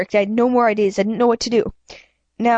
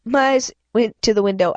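ordinary delivery

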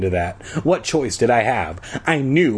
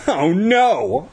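high, whiny groan; dismayed groan; 7 semitones higher than usual. A narrator's reading. Boy about to be forced to sing, high dismayed groan. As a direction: groan it in rising dread. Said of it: "I groaned".

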